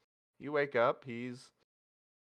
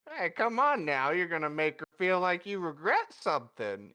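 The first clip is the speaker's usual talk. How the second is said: chiding; 6 semitones higher, 4 dB louder than usual. Acted. Puss teasingly chides embarrassed Zenith.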